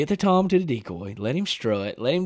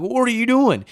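alarmed shout; 11 semitones higher, 6 dB louder than usual. Retold or imitated. Yelling at dad in the blind.

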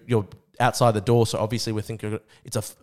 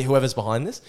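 normal talking voice

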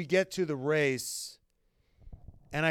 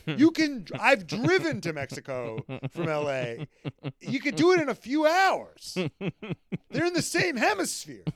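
high, loud, disbelieving; incredulous rant; 7 semitones higher, 4 dB louder than usual. Real, not acted. Host ranting about Daniel's question, high and loud. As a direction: pile on the outrage.

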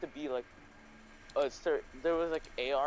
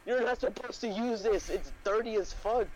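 imitating pissed mom; 7 semitones higher than usual. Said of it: "she was, like, pissed at me".

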